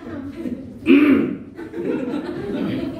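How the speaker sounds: imitated congregant's cough; put-on coughing hint; pointed throat clearing. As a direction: clear the throat long and obviously. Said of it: "batuk terus dia".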